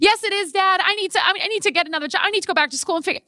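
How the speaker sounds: high, frantic protesting to dad; whiny protest. Retold or imitated. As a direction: plead like a stressed kid who won't listen. Arguing with dad on phone.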